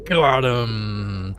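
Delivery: quick, pleased burst; triumphant shout. Real, not acted. Gamer landing the final blow, triumphant. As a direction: punch the win.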